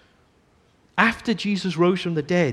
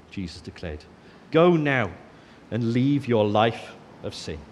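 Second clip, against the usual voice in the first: scripture reading; 5 semitones lower than usual. A narrator's reading. Preacher reading the adulterous woman story to congregation, gentle.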